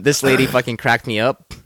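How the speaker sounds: ordinary talk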